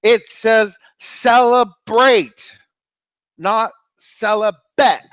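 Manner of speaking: high, drawn-out mock wail; hammed-up anguish, pitched high; distraught. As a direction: wail each key word slowly. Distraught Pope delivers the punchline.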